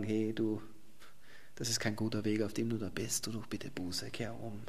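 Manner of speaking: gentle pleading warning. Acted. Prophets quietly urging repentance.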